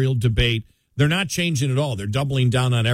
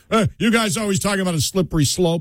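higher, dismissive imitation of a scoffer; mocking; 8 semitones higher than usual. Scoffer to radio hosts early in their career, mocking. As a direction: wave it off mockingly. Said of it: "And the mocking was there all the time".

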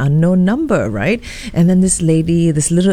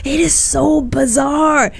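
incredulous; 7 semitones higher than usual. Real, not acted. Host marvelling that the story really happened, higher and amazed. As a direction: marvel at how bizarre it is.

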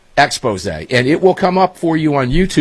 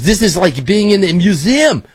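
higher-pitched put-on French accent; 6 semitones higher than usual. Quoting a foreign visitor marvelling at America, in a French accent. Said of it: "I'll do a French accent".

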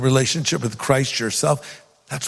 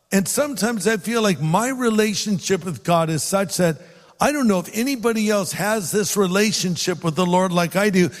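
ordinary delivery

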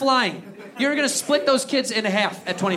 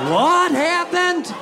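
mock surprise; 10 semitones higher, 5 dB louder, much slower than usual. Mock surprise after rant.

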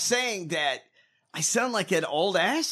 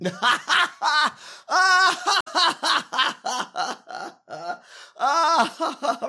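long, high, loud belly laugh; loud cackling laughter; 11 semitones higher, 6 dB louder than usual. Real, not acted. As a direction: crack up helplessly, high and loud. Cracks up at drooping face remark.

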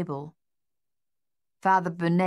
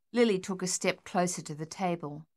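ordinary delivery, nothing marked

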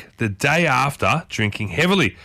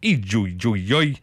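lower, tentative Chinese syllables; attempted Chinese accent; 3 semitones lower than usual. Shown as a demonstration. Telling viewers what they say in China, attempted Chinese accent. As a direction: try it haltingly in a rough Chinese accent. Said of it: "get better at these accents".

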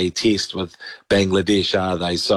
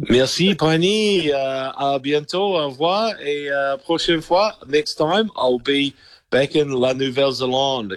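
put-on higher-pitched, playful French accent; hammed-up French goodbye, pitched up; mock French accent; 6 semitones higher than usual. Answers host's mock-French farewell in kind.

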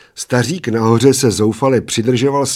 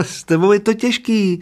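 put-on high, far-off-pitched whiny old man; lamenting little old man; 12 semitones higher than usual. A narrator's reading. Little old man complains to his drinking companion, lamenting. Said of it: "naříkal maličký stařík".

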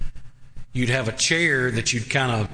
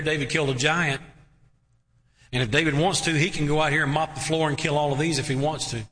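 normal talking voice

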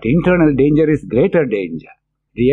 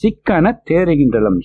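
rapid verse recital; 4 semitones higher, much faster than usual. Retold or imitated. Reciting the saint's verse to the congregation, fast chanted. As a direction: rattle off the Tamil line கெடக்கிடந்தனை-style, fast and a bit higher. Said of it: "So he says".

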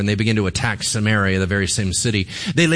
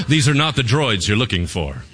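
Obi-Wan Kenobi impression. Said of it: "Obi-Wan Kenobi ripped off Elisha".

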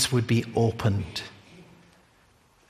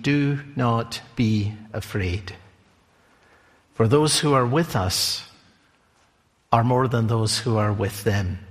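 calm prophet; 5 semitones lower than usual. Retold or imitated. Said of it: "And Elisha said".

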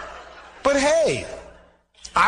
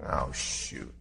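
deflated mutter; 13 dB quieter than usual. Retold or imitated. Deflated reply to friends.

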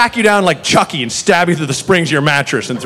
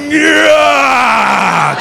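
triumphant yell; 7 semitones higher than usual. Retold or imitated. Small boss lifts the overloaded tray, triumphant yell. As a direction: yell as you heave a heavy tray. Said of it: "was like".

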